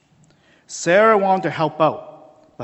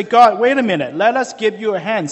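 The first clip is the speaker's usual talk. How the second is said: louder, higher mock interruption; protesting to God; 5 semitones higher, 7 dB louder than usual. The people interrupting God to offer help, mock protesting. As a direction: cut in on God with an eager, loud objection.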